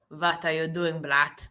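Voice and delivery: mock Russian accent, V for W; bad Russian accent. Shown as a demonstration. Streamer trying what a viewer suggested, hammed Russian accent. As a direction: put on a rough Russian accent. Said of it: "Like that?".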